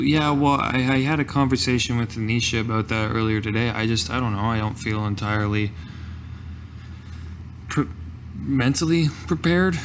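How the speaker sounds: normal talking voice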